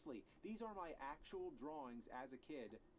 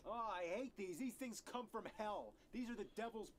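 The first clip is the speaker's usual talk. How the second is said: furious rant; 5 semitones higher, 4 dB louder than usual. Acted. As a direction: spit out the hatred for the hell device. Ranting about the switchbox.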